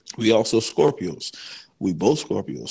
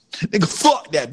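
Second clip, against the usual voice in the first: loud outburst; 6 semitones higher, 7 dB louder than usual. Real, not acted. Reversing his [name] decision.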